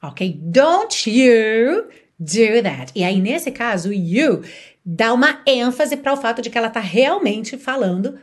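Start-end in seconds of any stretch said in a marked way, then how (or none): 0.4-2.9 very slow, louder stern warning; angry mother scolding her child, very slow and louder. Shown as a demonstration. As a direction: drag it out as a final warning. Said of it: "uma mãe brava dizer".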